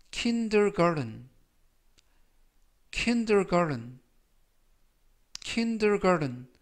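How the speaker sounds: kindergarten with the T softened to a flap, slow and careful; slow teacher demo of kindergarten with a soft flapped T. Shown as a demonstration. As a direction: say kindergarten slowly, garten sounding like "garen". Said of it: "T 발음이 부드럽게 트가 아니라 르".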